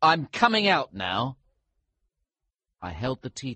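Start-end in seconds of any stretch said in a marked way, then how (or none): 0.0-1.4 loud, raised call through the door. A narrator's reading. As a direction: shout it out to those outside. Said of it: "I called".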